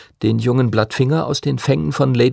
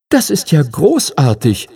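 louder, gladly excited; delighted; 6 semitones higher, 5 dB louder than usual. Acted. Young dragon delighted at Henry's plan, louder. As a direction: cheer at the good news. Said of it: "freute sich sein Drache".

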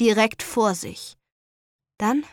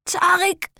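strangled cry, higher; choked; 9 semitones higher, 4 dB louder than usual. Acted. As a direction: choke the name out. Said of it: "würgte sie".

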